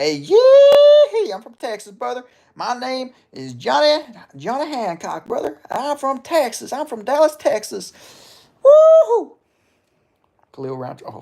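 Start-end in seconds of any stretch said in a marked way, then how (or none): 0.0-7.9 loud, much higher hammed-up Texan drawl; Texas accent. Streamer shows chat his Texas accent, loud and high. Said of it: "or you want me to talk like". 8.6-9.4 loud, very high yelp; cowboy whoop. Retold or imitated. Streamer ends the Texan bit with a loud whoop.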